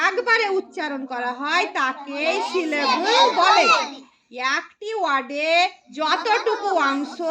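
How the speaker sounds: slow, measured teacher delivery; slow dictation. Shown as a demonstration. Teacher giving pupils a definition to repeat, slow dictation. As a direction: spell it out phrase by phrase, slowly.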